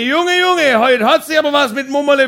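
neighbour yelling. Retold or imitated. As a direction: holler it over the fence with glee. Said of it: "Der Nachbar schrie".

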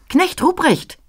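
calling out high and bright. Acted. Christ child calls to Knecht Ruprecht, high bright call. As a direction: call his name from afar, high. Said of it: "rief's mich mit heller Stimme an".

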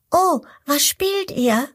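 high, curious little voice; eager to join in; little crocodile curious. Acted. Little crocodile asks the ducklings, high curious voice. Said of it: "fragt das kleine Krokodil".

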